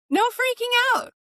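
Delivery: high, loud burst on freaking; playfully insisting, voice jumping up high; emphatic motto. Real, not acted. Playful argument, repeats her motto.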